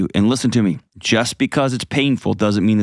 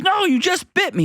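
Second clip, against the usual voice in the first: much higher, incredulous refusal; bitten guy. Retold or imitated. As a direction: squeak it out in disbelief. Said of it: "And he goes".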